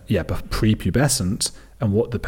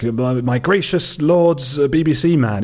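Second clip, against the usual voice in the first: slow, lightly hammed-up flattery, pitched up; mock grandiose address; 6 semitones higher, 4 dB louder, slower than usual. Exorcist emailing the BBC man, mildly grandiose. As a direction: imitate a fawning, flowery letter writer.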